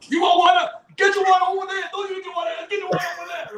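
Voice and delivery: high-pitched mock yell at the band; yelling bar owner. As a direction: bark it out like an angry bar owner.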